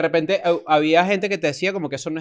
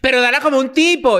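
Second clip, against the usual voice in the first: high-pitched mock demand; imitating his way of talking; 7 semitones higher, 3 dB louder than usual. Friend imitating how he demands a manly handshake, high mimicry. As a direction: mimic his indignant delivery, voice pushed up. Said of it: "Y en realidad es que él habla así".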